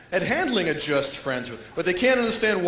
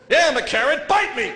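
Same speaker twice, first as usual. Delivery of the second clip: loud, high heckling vegetable; defiant carrot; 6 semitones higher, 5 dB louder than usual. Acted. Steamed carrot to the diner, loud and defiant. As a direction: taunt loudly as a cocky carrot.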